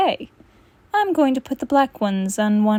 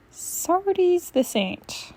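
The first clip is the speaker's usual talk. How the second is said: dry sarcasm; 8 semitones higher, 3 dB quieter, much slower than usual. Acted.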